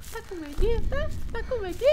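high mocking sing-song voice; squeaky taunt. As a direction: tease him in a squeaky voice. Friend taunts scared player.

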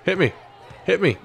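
ordinary talk, nothing marked

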